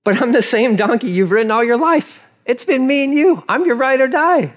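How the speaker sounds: high-pitched, protesting appeal; pleading donkey. Acted. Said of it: "donkey replies".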